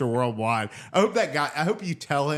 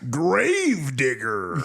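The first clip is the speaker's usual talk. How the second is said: announcer call; 3 dB louder, much slower than usual. Pretend. Calls out a monster truck name.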